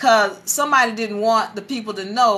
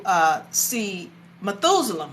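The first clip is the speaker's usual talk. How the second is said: normal talking voice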